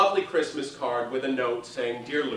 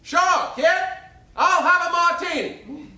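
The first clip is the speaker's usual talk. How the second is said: much higher, much louder brassy Broadway belt; 8 dB louder, slower than usual. Retold or imitated. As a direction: belt it to the second balcony. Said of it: "in that booming voice".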